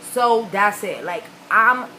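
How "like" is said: ordinary talk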